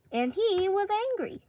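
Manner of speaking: high, loud storytelling swoop; hammed-up narrator reveal; dramatic announcement. A narrator's reading. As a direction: announce it big and high, falling at the end. Storyteller dramatizes villain's anger.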